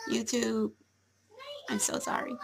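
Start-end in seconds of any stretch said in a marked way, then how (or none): 1.7-2.3 whisper. Real, not acted. Apologises after wrong greeting.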